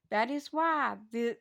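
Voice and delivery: usual speaking voice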